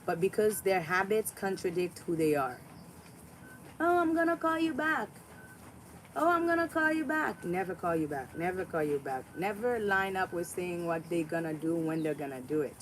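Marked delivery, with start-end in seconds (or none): 3.8-7.4 high, mocking fake-friendly voice; mocking a flaky hater. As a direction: mimic the hater's empty promise, sing-song. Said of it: "this is how they look".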